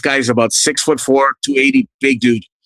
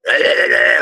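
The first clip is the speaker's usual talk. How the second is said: higher-pitched choked stuttering grunts; imitated struggling noises; 5 semitones higher, slower than usual. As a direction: imitate someone pinned and unable to move. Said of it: "like this".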